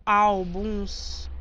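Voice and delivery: álbuns drawn out syllable by syllable; syllable separation. Shown as a demonstration. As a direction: split álbuns very slowly into its two syllables. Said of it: "Se você separar aqui".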